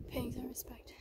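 hushed whisper in a temple. Real, not acted. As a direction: keep it to a whisper. I gotta talk really quiet.

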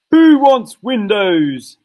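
hammed-up scornful old man; grumpy old man. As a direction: sneer the question slowly. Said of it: "Mister Twit had said".

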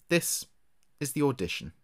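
ordinary delivery, nothing marked

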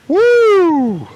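high loud whoop. Real, not acted. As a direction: let out a big woo. Reacting after spit take.

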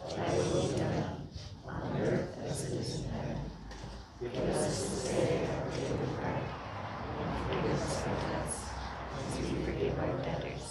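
hushed, unison prayer murmur; communal prayer. Acted. Pastor leading the congregation in the Lord's Prayer, hushed. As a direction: recite it reverently with the room.

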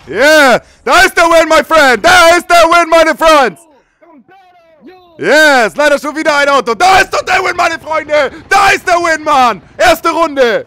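high, loud triumphant yelling; victory shout. Real, not acted. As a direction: roar the win out loud. Celebrating a won round.

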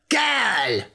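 imperious storybook witch; angry witch. A narrator's reading. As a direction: address her like a cross old witch. Angry witch arrives and addresses prince.

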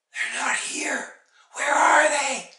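high, loud cry of alarm; worried mother's outcry. A narrator's reading. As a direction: realise they are gone, rising into a wail. Mother finds her children missing.

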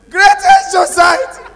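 loud high drawn-out rally cry; student yelling the school cry for help; slow shout. Retold or imitated. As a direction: bellow the school cry slowly.

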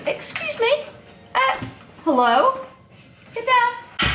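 high, sharp telling-off to pet; talking to a pet. Real, not acted. Scolding a pet off something.

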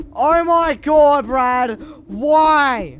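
high, loud, slowed shout at Brad; outraged yell. Real, not acted. Gamer yells at Brad over his message, high and loud. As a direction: yell in disbelief at your mate.

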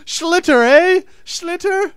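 high, loud mimicking jeer; very high mock-accent taunt. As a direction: parrot the slurred word back, shrieking it. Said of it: "Did you making fun of my accent".

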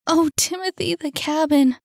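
high-pitched distressed wail. A narrator's reading. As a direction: wail it out in dismay. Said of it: "wailed Saphira".

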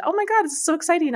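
high, fast, gushing retold announcement; excited quote. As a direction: rattle it off bursting with excitement.

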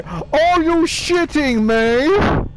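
slipping into a British accent, high and loud; panicked British accent. Real, not acted. Gamer slipping into British while panicking at Chica. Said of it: "I went a bit British there".

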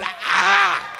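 high, loud cramp yelp; mock pained cry. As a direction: yelp as if your leg seized. Charlie horse cramp.

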